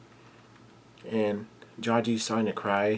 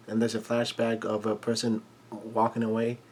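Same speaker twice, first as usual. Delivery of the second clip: ordinary talk, nothing marked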